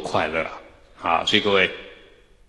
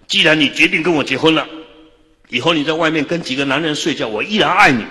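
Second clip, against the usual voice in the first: higher, louder earnest pledge to bride; declaring it with conviction, higher; earnest vow; 8 semitones higher, 5 dB louder than usual. Retold or imitated. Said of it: "我还跟我太太说". (对未婚妻激动地发誓，声音高亢)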